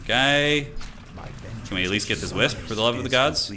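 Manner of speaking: ordinary talk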